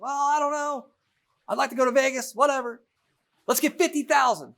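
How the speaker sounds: a carefree husband dreaming of Vegas, voiced high; John voiced. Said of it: "And John's like".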